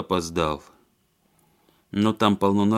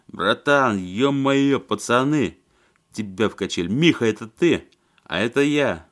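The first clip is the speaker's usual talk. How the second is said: put-on loud drunk bellowing; drunken yelling; 3 semitones higher, 4 dB louder than usual. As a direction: yell over the music, drunk. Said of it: "с пьяным упорством орал в трубу".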